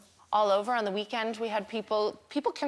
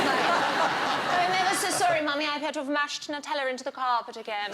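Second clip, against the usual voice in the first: higher, tiny English butler voice; English child butler; 4 semitones higher than usual. Retold or imitated. Daughter confessing a carpet mess to Mummy, mock English butler accent. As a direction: imitate an apologetic, very English little daughter. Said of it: "Violet is so English. It's like having a tiny, ineffective butler".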